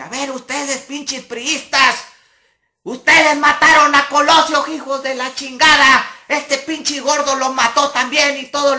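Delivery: high, loud shouted tirade; furious political rant. Retold or imitated. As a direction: rage at the crowd like a protester seizing the mic. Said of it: "estaba yo de encabronado".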